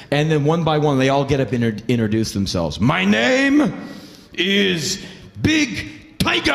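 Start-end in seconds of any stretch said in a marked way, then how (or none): 2.8-6.5 put-on shouted drunken introduction; drunk cab driver. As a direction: announce yourself like a proud drunk.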